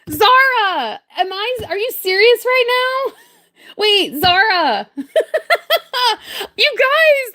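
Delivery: high, laughing disbelief; stunned excitement. Real, not acted. Streamer hearing she hit forty thousand subs, laughing high in disbelief. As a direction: squeal it out like you can't believe it. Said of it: "I am so excited".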